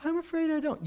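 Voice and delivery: mock listener.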